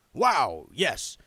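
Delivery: loud, staged remark; said loudly. A narrator's reading. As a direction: say it loud so the bogey hears. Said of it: "he said loudly".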